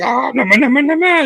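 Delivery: lightly voiced high anime whine; a hint of a powering-up anime hero; Super Saiyan protest. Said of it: "It's like".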